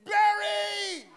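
high, loud excited yell at a star; fanboy shout. Retold or imitated. Fan spotting Barry Sanders at the airport, excited yell. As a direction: starstruck fan yelling. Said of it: "Barry about to look at me".

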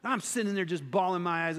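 ordinary talk, nothing marked

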